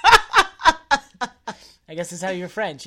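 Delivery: slow, loud words through laughter; laughing. Real, not acted. Laughing while talking.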